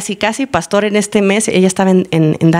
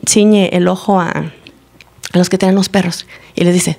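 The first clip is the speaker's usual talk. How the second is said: ordinary talk, nothing marked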